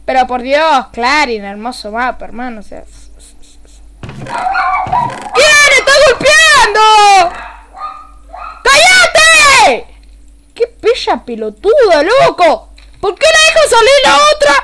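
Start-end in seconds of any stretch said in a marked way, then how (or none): 4.0-9.9 high, very loud, drawn-out shout; real furious yell. Real, not acted. Streamer interrupted by a noisy dog, furious yelling. 10.5-14.5 loud, high rant building to a scream; exasperated yelling. Real, not acted. Streamer raging about who let the dog out, angry yelling.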